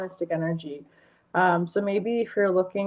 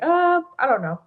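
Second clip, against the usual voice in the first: imitating a doubtful friend; 10 semitones higher, 3 dB louder than usual. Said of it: "she'll be like".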